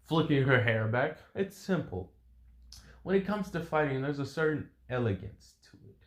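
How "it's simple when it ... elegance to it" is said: higher, softened, elegant woman's voice; poised female fighter. Acted. Woman fighter explains fighting in heels to opponent, poised. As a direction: explain it with airy self-assurance.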